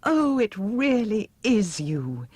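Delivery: high, slow and delighted; joyous reunion; joyful. Acted. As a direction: beam with relief at seeing him. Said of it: "Dorothy cried for joy".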